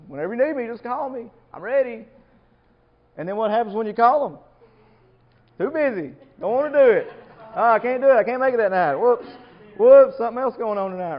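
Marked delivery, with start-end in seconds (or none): 0.1-2.1 eager promise. Retold or imitated. Voicing an eager believer's promise. 5.6-11.2 higher, much louder whiny excuses piling up. Retold or imitated. Believer loudly whining excuses when Jesus calls him.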